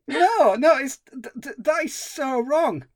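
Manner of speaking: high, loud, sputtering no no; real outrage, pitch jumping up; appalled disbelief. Real, not acted. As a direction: recoil in horror at the idea. Outraged reaction to film line.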